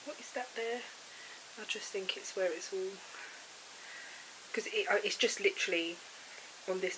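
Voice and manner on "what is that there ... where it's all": ordinary talk, nothing marked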